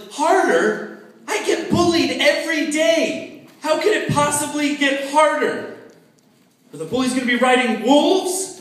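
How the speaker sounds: high, loud incredulous outburst; exasperated kid. Retold or imitated. Bullied kid shouting at his dad in disbelief. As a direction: yell it like a fed-up bullied kid. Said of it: "I was like".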